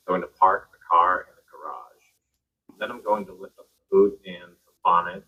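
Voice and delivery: lightly put-on American car talk, slowed right down; mock American accent. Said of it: "the way they say it".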